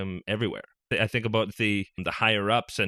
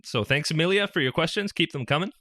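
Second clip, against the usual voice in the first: ordinary talk, nothing marked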